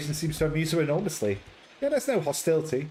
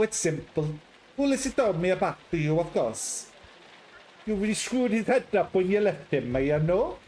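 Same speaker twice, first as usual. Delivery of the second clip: put-on chatty, higher Italian accent; 5 semitones higher than usual. Patrizia telling how she met Ulysses, chatty Italian accent. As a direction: gossip in a friendly Italian lilt.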